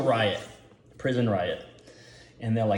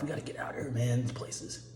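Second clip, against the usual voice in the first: hushed, much softer aside; Marv; 12 dB quieter, faster than usual. Acted. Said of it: "You know, that's Marv".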